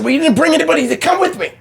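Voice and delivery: high, quick mock complaint; protesting kid. Son arguing with his mother in a story, indignant whine. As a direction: argue back like an aggrieved child.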